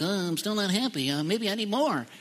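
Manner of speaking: lightly voiced worried chaser of stuff, mocking tone; mocking anxious people. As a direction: mimic a nervous go-getter. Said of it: "they're like".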